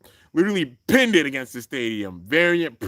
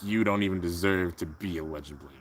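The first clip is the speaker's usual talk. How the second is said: low, hushed villain put-down; imitating a cold, quiet villain; menacing contempt; 10 semitones lower, 9 dB quieter, faster than usual. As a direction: speak down to a rival, deep and quiet. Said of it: "Lane's just looking menacing".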